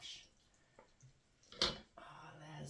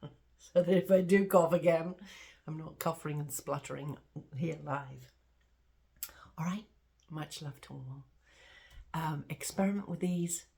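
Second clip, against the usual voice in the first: ordinary talk, nothing marked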